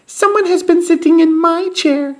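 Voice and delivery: tiny, very high bear-cub voice; squeaky Baby Bear. A narrator's reading. Baby Bear notices someone used his chair, tiny squeak. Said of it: "squeaked Baby Bear in his tiny little voice".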